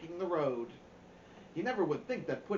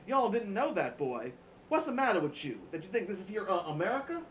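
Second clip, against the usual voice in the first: Dad's put-on Southern accent, a bit higher and louder; a father hamming up a Southern accent for the kids; Southern drawl; 4 semitones higher, 4 dB louder than usual. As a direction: ham up a folksy Southern accent, teasing. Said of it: "say some more Southern-style stuff".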